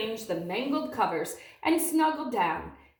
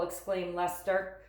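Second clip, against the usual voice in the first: ordinary delivery, nothing marked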